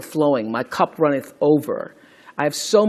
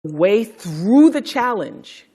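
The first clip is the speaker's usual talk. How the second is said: usual speaking voice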